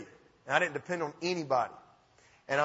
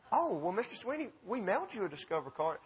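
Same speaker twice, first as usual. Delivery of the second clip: soft, sing-song customer service lady; hushed imitation of a call-center lady; lightly voiced phone agent; 2 semitones higher, 8 dB quieter than usual. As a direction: play a gently placating phone operator, lightly. Voices the card company lady on phone.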